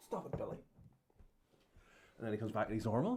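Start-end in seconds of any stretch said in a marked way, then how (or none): 0.1-0.6 whispered, softer mock self-scolding; imitating Billy Connolly. Retelling Billy Connolly stopping himself, whispered. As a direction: hush yourself like a man holding back tears. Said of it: "um, and he goes".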